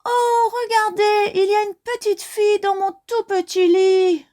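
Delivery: very high piercing cub cry; very high little bear cry. Acted. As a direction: squeal it like an excited tiny bear. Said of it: "crie de sa petite voix perçante".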